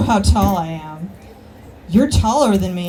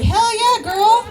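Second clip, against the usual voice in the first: hammed-up eager country boy, pitched way up; 9 semitones higher than usual. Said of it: "this one redneck motherfucker".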